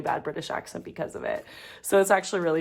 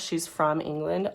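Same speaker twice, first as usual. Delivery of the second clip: ordinary delivery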